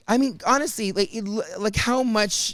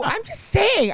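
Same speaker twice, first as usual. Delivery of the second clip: much higher, breezy flamboyant tone; slower than usual. Retold or imitated. Teasing the security guard, high and flamboyant. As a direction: play it flamboyant to throw him off. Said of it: "acted a little flamboyant".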